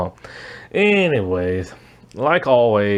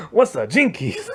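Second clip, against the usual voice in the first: giggle, then ask what it means; laughing confusion; 3 semitones higher than usual. Retold or imitated. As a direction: laugh through the question, pitched up. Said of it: "Johnny's kinda like".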